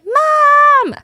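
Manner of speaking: loud, very high-pitched whine; whiny kid calling mom. Retold or imitated. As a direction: imitate a grown man whining for his mother. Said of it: "whining for his mom".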